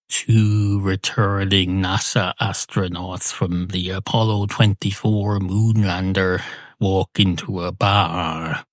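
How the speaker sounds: slow, deliberate joke narrator; slow joke telling. Acted. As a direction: stretch out the words like a stand-up joke set-up.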